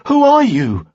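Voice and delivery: loud, high, forceful question; demanding. A narrator's reading. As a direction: demand an answer from the stranger. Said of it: "Giui demanded".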